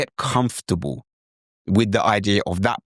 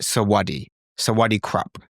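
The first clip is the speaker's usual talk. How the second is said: English-accented Thai. Shown as a demonstration. Teacher showing English people's way, English-accented Thai. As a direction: direct British-accented Thai greeting. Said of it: "That's how a lot of English people would say it".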